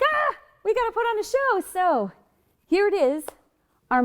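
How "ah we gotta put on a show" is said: high-pitched waking-up realization; sudden panic. Acted. Teacher imitating waking up with a show to stage, mock panic. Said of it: "you wake up and you're like".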